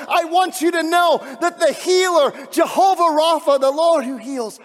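loud, high, ringing preacher's proclamation; fiery sermon climax; full-force preaching. Acted. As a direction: build it and proclaim it to the rafters.

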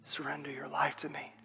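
a hushed divine I love you; God's whisper. Acted. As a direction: whisper it like a loving God. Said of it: "you hear the whisper of the Spirit".

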